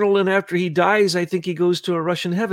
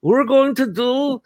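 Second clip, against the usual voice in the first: lightly put-on Russian accent, pitched higher; imitating a Russian official in a Russian accent; 6 semitones higher than usual. Mimicking Molotov for the host in a Russian accent. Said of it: "watch your Russian accent".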